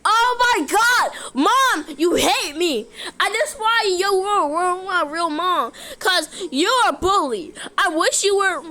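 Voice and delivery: high pretend tantrum; upset child. Child tells mom off for hating them, high tantrum. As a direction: protest in a wounded whine.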